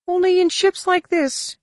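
very high, quick alien voice; Droma's retort. Acted. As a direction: snap back in a squeaky alien voice. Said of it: "Droma fired back".

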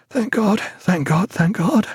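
patient thanking God after a nightmare; broken with horror. A narrator's reading. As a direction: gasp out thanks through terror. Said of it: "his voice broken with the horror".

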